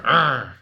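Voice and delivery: loud, high, annoyed groan; disgusted ugh. Shown as a demonstration. Contrasting the angry sound he always made, loud groan. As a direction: spit out the ugh in anger. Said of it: "I always said".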